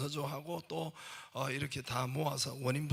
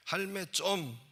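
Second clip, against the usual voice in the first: punchy Gyeongsang dialect, pitched up; 5 semitones higher, slower than usual. Shown as a demonstration. Squeezing the sentence into three syllables, Gyeongsang dialect. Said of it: "경상도 사투리로 이거 세 글자로".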